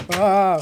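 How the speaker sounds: short, loud startled yell; sudden cry of alarm. Real, not acted. Player startled mid-sentence, loud yell.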